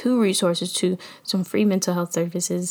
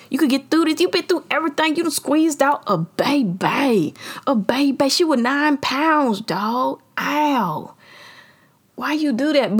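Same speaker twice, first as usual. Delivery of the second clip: higher-pitched motivational self-talk; self pep talk; 5 semitones higher than usual. Retold or imitated. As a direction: hype yourself up like your own coach. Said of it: "I go tell myself".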